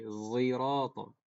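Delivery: "Zirata" drawn out slowly, z sound, o vowels; slow zai recitation. Shown as a demonstration. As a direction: stretch "Zirata" with a buzzing z. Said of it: "condong ke huruf zai".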